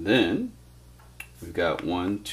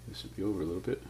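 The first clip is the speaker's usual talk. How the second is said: whispered aside; 9 dB quieter, much faster than usual. Real, not acted. Correcting his drawing under breath.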